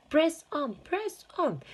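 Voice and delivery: urging them onward at a run; shouting. A narrator's reading. Mr Wonka hurrying the group, shouting. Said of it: "shouted Mister Wonka".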